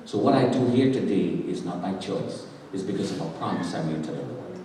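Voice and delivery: very low, soft solemn confession; near-whispered testimony; hushed testimony. Real, not acted. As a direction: confide it gravely to the congregation.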